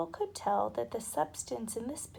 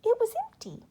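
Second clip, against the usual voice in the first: put-on much higher, far-off child voice; hushed wonder of a small boy; awed little boy; 13 semitones higher, 7 dB louder, faster than usual. A narrator's reading. Michael to Jane about the bag, high and awed.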